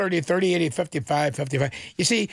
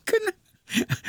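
laughing. Real, not acted. Laughing at his own joke.

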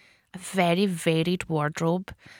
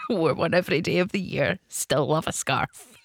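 laughing while reading; 4 semitones lower than usual. Real, not acted. Reading a listener's message while laughing.

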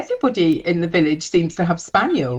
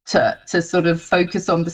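ordinary talk

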